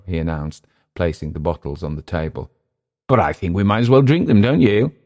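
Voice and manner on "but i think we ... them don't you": eager tipsy. A narrator's reading.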